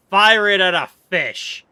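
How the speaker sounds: mock battle cry. Streamer fake-raging at a fish, loud mock battle cry. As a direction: bellow it like a cartoon villain. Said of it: "I'm mostly just playing".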